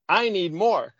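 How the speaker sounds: laughing through the words, pitched a bit up; amused retelling, laughing at the mix-up. Retold or imitated. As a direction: giggle it out as a funny misreading. Retelling his reading, laughing.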